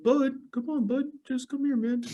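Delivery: high, soft wheedling voice; coaxing roommate. Retold or imitated. Imitating the roommate coaxing him out with steak, high and soft. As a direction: coax him out sweetly, like a pest. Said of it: "be like".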